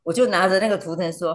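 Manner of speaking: ordinary talk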